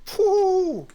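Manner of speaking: high, loud startled phew; loud shocked whistle. Acted. Referee boss reacts to insult.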